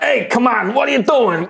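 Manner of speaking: put-on high, irritated drunk whine; drunk barkeep. Game master voicing the drunk barkeep, irritated. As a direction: pitch it up and complain groggily. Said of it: "He's like".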